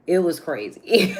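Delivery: laughing it out in disbelief. Real, not acted. Laughing while retelling stalker story.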